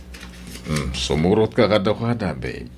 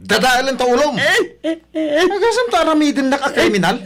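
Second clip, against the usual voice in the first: much higher, louder enraged threats; furious shouting; 3 dB louder than usual. Acted. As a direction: yell back, losing it. Husband shouts threats back.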